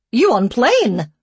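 high, loud slow broken English; blunt question in broken English. A narrator's reading. Care worker questioning the couple, loud broken English. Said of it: "in broken English".

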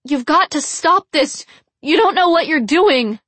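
high, loud desperate plea; woman's yell. A narrator's reading. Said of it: "Another woman yelled".

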